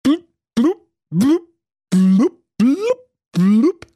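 high, loud happy bubbling; joyful blub. Acted. Prince to his mermaid underwater, joyful high blubbing. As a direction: burble with joy underwater. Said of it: "er rief voller Freude".

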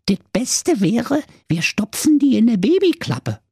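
high, put-on Berlin wife voice; scheming wife. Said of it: "erwiderte die Frau".

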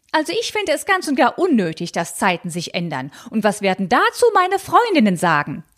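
high, fussy, scandalized aunt voice; high fretful aunt clutching pearls; prim old aunt. Acted. Tante Malchen voiced in reading.

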